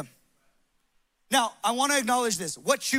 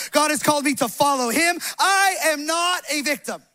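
emphatic, insistent affirmation; preached declaration; 3 semitones higher, 4 dB louder than usual. Real, not acted. Pastor modelling a morning declaration for the congregation, forceful preaching. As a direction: declare it firmly, line after line.

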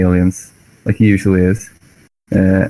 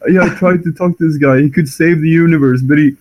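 mock put-upon complaint, much higher; whiny alien report; 8 semitones higher than usual. The Eldar complaining to his boss, hammed-up whiny voice. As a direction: sulk about the stubborn brute. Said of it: "He just tells his boss".

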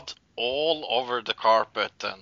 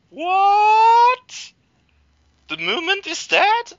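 much higher, loud stunned cry; shocked disbelief; 8 dB louder, slower than usual. Retold or imitated. Cal hearing his movement is dead, shocked. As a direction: gasp out the news in disbelief.

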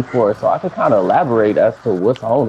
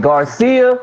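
called out name; 11 semitones higher than usual. Retold or imitated. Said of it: "and then he was like".

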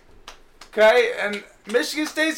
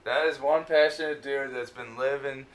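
mock announcer; 11 semitones lower, 7 dB quieter than usual. Host mock-announces co-host's passion.

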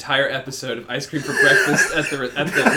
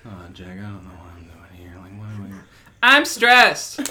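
impression of co-host; 7 semitones lower, 5 dB louder than usual. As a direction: play the flustered co-host, rising into panic. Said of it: "With correct impressions".

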